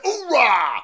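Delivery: high, loud Marine battle cry; loud gung-ho soldier. Pretend. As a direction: bark it like a jarhead.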